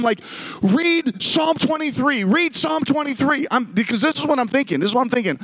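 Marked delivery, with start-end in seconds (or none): 0.6-3.5 slow, high-pitched mock wail; mock-panicked plea, drawn out and high; retold screaming. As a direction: drag out a desperate kid's demand, pitched way up. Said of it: "and I'm screaming".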